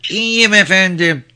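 put-on very high child's voice; high-pitched little pupil reciting; little girl. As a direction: recite politely as a small child. Small child answers politely.

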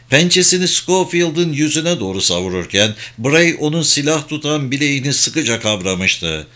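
usual speaking voice

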